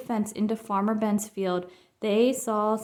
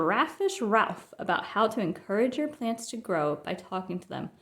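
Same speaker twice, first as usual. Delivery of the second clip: usual speaking voice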